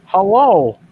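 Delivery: big bright hello to the chat; loud greeting. Real, not acted. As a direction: call out hello to the viewers. Opening a livestream greeting.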